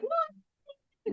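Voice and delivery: very high, shocked "What"; mock shock. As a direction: squeak it in disbelief. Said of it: "and I'm like".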